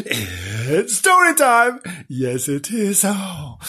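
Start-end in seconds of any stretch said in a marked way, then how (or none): 0.1-1.8 high, drawn-out storytime fanfare; slow, rising announcer cry; excited announcement. Pretend. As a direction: herald story time in a high, stretched call. Host announcing story time to children.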